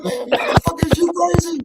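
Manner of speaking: worked-up driver ranting at the company; incredulous rant. Real, not acted. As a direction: ask it like they've lost their minds. Ranting about DoorDash.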